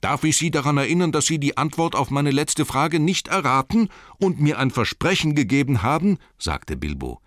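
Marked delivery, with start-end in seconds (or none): 0.0-6.2 high, raised, pressing reminder; insistent. A narrator's reading. Bilbo reminds Gollum of his promise, insistent protest. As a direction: argue your right in a lifted voice.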